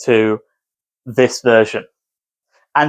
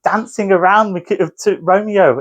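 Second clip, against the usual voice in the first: ordinary delivery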